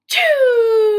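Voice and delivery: loud, high sneeze burst; acted sneeze. Gerald sneezing at Piggy, loud and sudden. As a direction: blast out a big sneeze.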